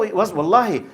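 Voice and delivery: usual speaking voice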